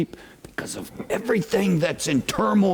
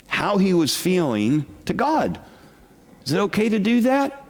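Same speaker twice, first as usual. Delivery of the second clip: ordinary talk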